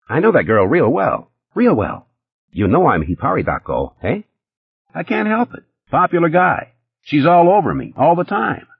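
high, fast-talking nervous hustler; Eddie's voice. A narrator's reading. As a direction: pitch it up as jittery Eddie.

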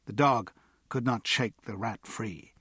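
usual speaking voice

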